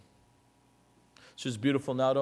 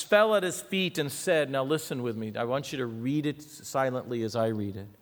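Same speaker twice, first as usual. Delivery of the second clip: usual speaking voice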